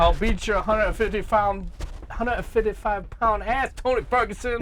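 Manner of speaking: trash talk like Fabricio Werdum; mock Brazilian fighter accent. Fighter threatening Tony Ferguson in a mock Brazilian accent. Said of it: "I don't know what accent I'm talking in".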